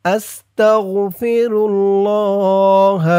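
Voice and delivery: drawn-out Arabic recitation of আস্তাগফিরুল্লাহ, each sound held; slow Quranic-style recitation of আস্তাগফিরুল্লাহ. Shown as a demonstration. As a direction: chant আস্তাগফিরুল্লাহ slowly for the class to copy. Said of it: "কি আমার মতো হয় কিনা আপনার".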